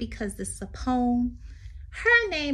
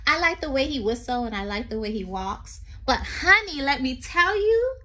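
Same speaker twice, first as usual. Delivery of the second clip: sing-song read-aloud poem voice; 3 semitones higher, 5 dB louder than usual. Acted. Teacher reading a poem to children, lilting.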